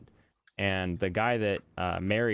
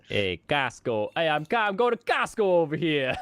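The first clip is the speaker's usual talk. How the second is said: put-on Boston accent; 7 semitones higher, 4 dB louder than usual. Host riffing on the guest's pronunciation, mock Boston accent. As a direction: ham up a Boston local.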